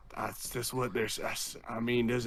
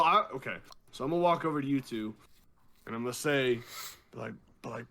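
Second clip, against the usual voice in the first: normal talking voice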